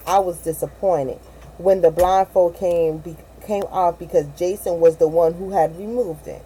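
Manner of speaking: usual speaking voice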